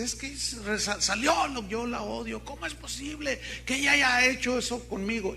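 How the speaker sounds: put-on high, halting voice of a hurt girl; imitated distraught girl. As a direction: act out her stumbling, disbelieving protest. Imita la protesta de la joven. (imitando a una joven dolida, voz aguda)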